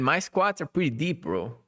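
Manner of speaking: normal talking voice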